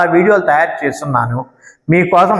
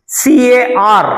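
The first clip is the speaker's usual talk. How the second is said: each letter spelt out slowly; slow letter spelling; 6 semitones higher, much slower than usual. Shown as a demonstration. Teacher spelling a word slowly for students. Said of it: "ని ఎలా పలకాలి అంటే".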